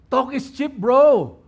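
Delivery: high, punched taunt with a loud "bro"; taunting critic. Acted. Repeats the critic's jab, heightened.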